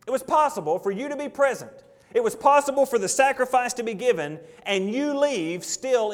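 high, loud, driving pulpit voice; fiery preaching. Acted. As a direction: hammer home each It was possible line. Preaching at full force.

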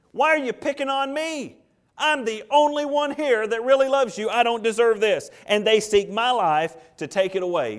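high, loud, petulant whine; whiny complaining. Acted. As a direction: complain like a sulking child. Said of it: "It's what we call complaining".

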